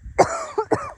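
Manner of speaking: high, harsh coughing fit; choking cough on a bug; just swallowed a bug. Real, not acted. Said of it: "Oh, I just inhaled a bug".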